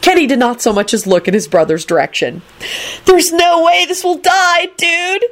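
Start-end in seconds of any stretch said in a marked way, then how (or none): 3.1-5.2 very high, stretched-out cry; high, overwrought dude plea; desperate scream. A narrator's reading. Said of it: "he screamed desperately".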